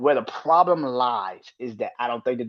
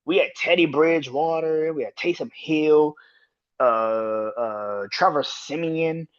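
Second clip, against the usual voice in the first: ordinary delivery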